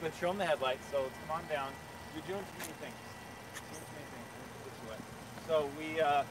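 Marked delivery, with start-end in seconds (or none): 2.1-5.0 much softer, a quick aside to the camera person; hushed aside. Real, not acted. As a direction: drop your voice to steer the camera. Aside directing the camera operator.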